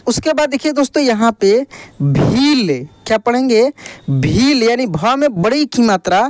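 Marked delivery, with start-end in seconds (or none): 2.0-2.9 भील with the ī held long; drawn-out long ī. Shown as a demonstration. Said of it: "इसका उच्चारण खींच कर होता है". 4.1-4.6 भील with the ī held long; drawn-out long ī. Shown as a demonstration. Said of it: "इसका उच्चारण खींच कर होता है".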